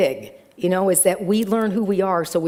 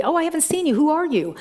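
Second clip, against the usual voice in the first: bright welcoming minister; 8 semitones higher, faster than usual. Retold or imitated. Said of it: "and she said to me".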